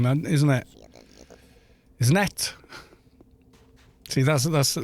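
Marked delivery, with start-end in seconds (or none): 2.0-2.5 South Walian accent. Shown as a demonstration. Streamer showing chat the expected Welsh sound, South Walian accent. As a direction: put on a South Wales lilt. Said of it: "the only Welsh accent is the South Walian".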